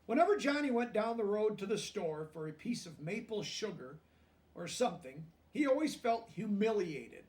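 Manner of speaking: lively storybook reading aloud; reading to children. A narrator's reading. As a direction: read it out to the class, bright and clear.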